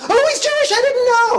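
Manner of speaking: squealing mock surprise. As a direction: play the student suddenly realizing.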